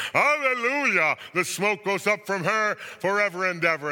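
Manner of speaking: full-voiced cry of a crowd; jubilant shouting. A narrator's reading. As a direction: shout it out in triumph. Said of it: "And once more they shouted".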